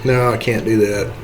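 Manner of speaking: usual speaking voice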